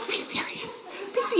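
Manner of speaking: whispered mock scolding from the mothers; a whispered be serious; hushed scolding whisper. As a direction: hiss it softly like a stern mother. Mothers hushing giggling groomsmen.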